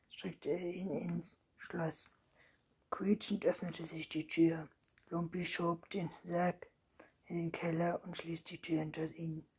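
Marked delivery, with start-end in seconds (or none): none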